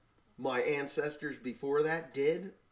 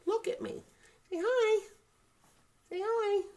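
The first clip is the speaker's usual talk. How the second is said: very high, coaxing coo; 3 dB louder than usual. Real, not acted. Father coaxing smiley baby to greet camera, high baby talk. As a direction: coax an infant in a tiny high voice.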